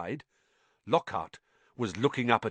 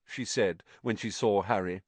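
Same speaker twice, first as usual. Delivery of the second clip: plain reading voice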